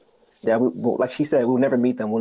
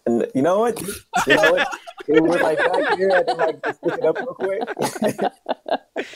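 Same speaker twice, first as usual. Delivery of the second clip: laughing; 11 semitones higher, slower than usual. Real, not acted. Guest caught out on air, laughing sheepishly. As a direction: caught out and laughing through the words. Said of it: "Did you almost say spiritual food?".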